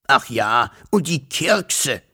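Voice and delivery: slow, breathy dragon hiss. Acted. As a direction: hiss it out slowly like a sleepy dragon. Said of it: "fauchte der Drache".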